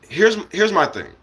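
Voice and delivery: ordinary talk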